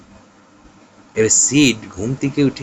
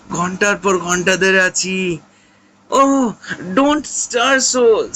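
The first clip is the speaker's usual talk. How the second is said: higher, drawn-out mock moans of pain; faking agony to his brother, higher, slow moaning; hammed-up agony; 10 semitones higher, 3 dB louder, much slower than usual. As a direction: overplay dying pain to scare him. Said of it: "তখন Tom উত্তর দিচ্ছে".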